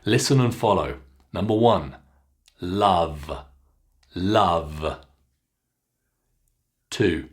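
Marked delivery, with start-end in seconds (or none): 2.6-5.0 "love" with short open ah, kept short; short ah sound. Shown as a demonstration. Teacher modelling "love" twice for learners to copy. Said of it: "continue the short ah sound".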